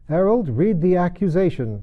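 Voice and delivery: a pompous king giving an order; king's command. A narrator's reading. King in court orders the herald, loud and high. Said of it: "said the king".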